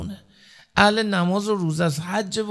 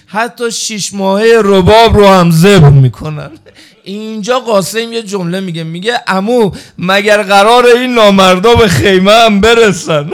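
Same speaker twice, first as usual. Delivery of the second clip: much louder, wailing sermon climax; impassioned rowzeh cry; full-force preaching; 2 semitones higher, 12 dB louder than usual. Acted. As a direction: cry out the tragedy to the mourners. Climactic lament for Qasim.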